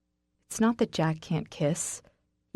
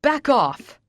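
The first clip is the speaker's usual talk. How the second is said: loud, high roar; furious roar; 8 semitones higher, 7 dB louder than usual. Acted. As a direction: roar it at him. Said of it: "Raynor roars".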